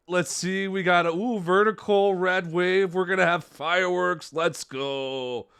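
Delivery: excited. Real, not acted. Big card pulled, thrilled.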